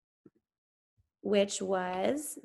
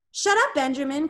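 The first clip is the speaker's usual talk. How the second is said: louder, higher snap at a friend; sharp cry; 5 semitones higher, 5 dB louder than usual. A narrator's reading. Charlie silencing Benjamin so he can listen, sharp cry. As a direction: cut him off sharply. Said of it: "cried Charlie".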